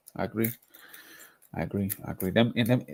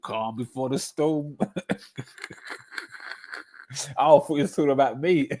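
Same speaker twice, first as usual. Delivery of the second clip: ordinary delivery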